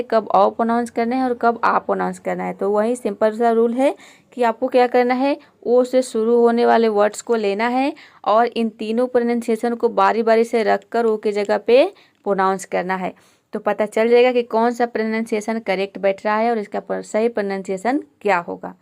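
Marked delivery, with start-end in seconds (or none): none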